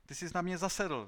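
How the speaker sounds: high, loud outburst; accusing cry. A narrator's reading. Inventor accusing Konos of bias, crying out. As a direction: cry out as if wronged. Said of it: "vykřikl Klorindo".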